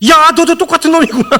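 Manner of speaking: high, loud accusing yell; hammed-up furious yell; mock angry outburst. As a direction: throw the accusation like a betrayed friend. Offended friend lashes out.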